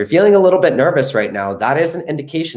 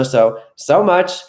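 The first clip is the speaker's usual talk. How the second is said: affectionate; 7 semitones higher, much slower than usual. Real, not acted. Fond sign-off to viewers.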